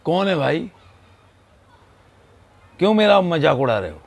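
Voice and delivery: loud, high angry yell; angry shout. Retold or imitated. Boy yelling at the echo, angry shout. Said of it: "उसने ज़ोर से चिल्ला कर बोला".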